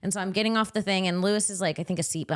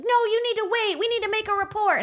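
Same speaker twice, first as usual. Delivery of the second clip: high, prissy steward voice; mock steward; faster than usual. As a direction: lift way up like a fussy flight steward. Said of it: "the steward's like".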